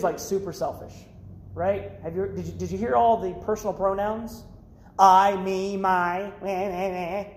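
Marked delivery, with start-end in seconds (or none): 5.0-7.2 mock whining. Mimicking the selfish brother's whining.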